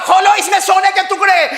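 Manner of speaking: high, loud impassioned preaching; fiery storyteller's shout; preaching at full force. Acted. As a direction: belt the story out to the crowd. Sermon climax shout.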